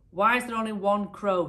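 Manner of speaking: high, loud indignant demand; a minister pale with anger, snapping a question. Acted. Angry minister demanding an answer from the tailor, loud and high. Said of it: "he demanded".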